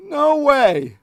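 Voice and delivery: high, loud cry of disbelief; shocked no way. Real, not acted. Streamer shocked by a Pokemon's name. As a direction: yelp it in total shock.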